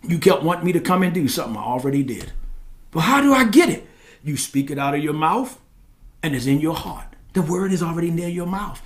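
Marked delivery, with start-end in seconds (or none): 2.9-3.9 puzzled believer. Acted. Voices a questioning believer.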